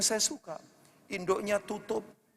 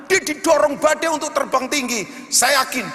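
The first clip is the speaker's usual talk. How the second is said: loud, fervent sermon over music; loud, higher impassioned preaching; full-force preaching; 4 semitones higher, 6 dB louder than usual. Acted. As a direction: preach it out, pushing the congregation. Preaching climax to amen call.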